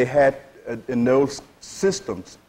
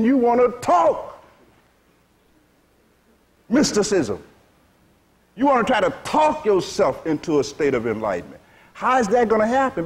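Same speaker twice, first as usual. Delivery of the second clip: ordinary talk, nothing marked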